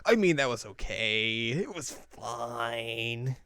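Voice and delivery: drawn-out, reluctant admission; grudging mock concession. Outplayed guitarist admits it to the group, grudgingly. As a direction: concede it slowly, trailing very long on the last word.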